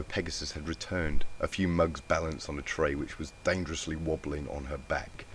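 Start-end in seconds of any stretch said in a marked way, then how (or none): none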